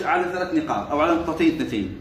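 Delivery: normal talking voice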